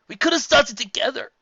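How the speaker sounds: high, loud choked cry. A narrator's reading. Spike choking up at Twilight. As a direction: voice cracking on every word. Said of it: "he choked".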